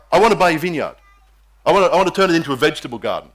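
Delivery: loud, fast royal demand; demanding king. Acted. King Ahab demands the vineyard from Naboth, loud and pushy. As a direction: push the offer hard and loud.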